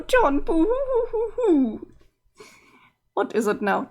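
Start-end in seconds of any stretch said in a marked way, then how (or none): 0.0-1.9 mock boo-hoo sobbing. Sprite grieving, remembering John.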